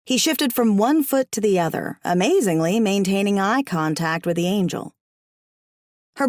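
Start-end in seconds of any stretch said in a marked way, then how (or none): none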